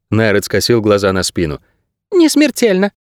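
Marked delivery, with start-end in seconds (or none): 2.1-2.9 high, thin little bird; squeaky bird guide. A narrator's reading. Bird guide dismissing his ward's wounds, high squeak. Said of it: "пропищал проводник".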